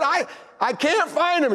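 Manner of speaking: high, drawn-out protest; calling back. Retold or imitated. Husband calling back to his wife from pantry, hollering. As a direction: holler back in mock helplessness.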